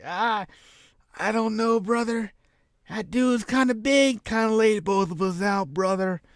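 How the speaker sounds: high, put-on dopey Disco voice; Disco impression. Disco backing out of the fight, high put-on voice. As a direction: squeak it out like a nervous wrestler. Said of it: "Disco's like".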